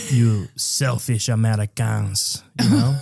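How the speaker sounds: put-on slow, scornful French accent. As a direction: scold them as a haughty Frenchman. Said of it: "I'm doing French".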